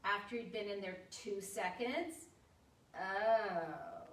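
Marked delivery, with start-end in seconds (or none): 2.9-4.0 low, drawn-out letdown; dismayed, slow falling tone; unimpressed parent's oh. Shown as a demonstration. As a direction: let the doubt sink into a falling oh. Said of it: "with your teenage child".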